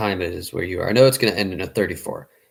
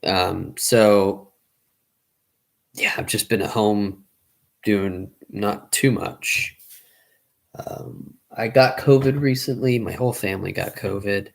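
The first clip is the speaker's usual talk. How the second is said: ordinary talk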